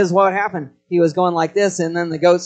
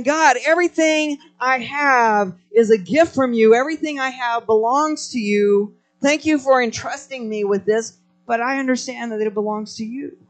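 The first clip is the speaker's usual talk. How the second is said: voiced prayer to God; 8 semitones higher than usual. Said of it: "we're saying".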